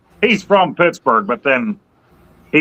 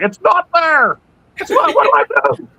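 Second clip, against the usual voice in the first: high, fast, frantic whine; hammed-up panic at the GPS; mock panic. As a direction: play up the helpless freak-out, much higher. Panicking at GPS, ramp gone.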